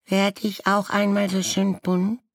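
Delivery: put-on very high small child voice; a tiny bird asking hopefully; sparrow chick. A narrator's reading. Sparrow chick asking its mother, very high childlike voice. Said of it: "fragte ein Spatzenkind".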